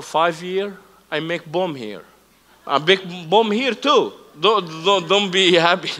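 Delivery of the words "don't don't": usual speaking voice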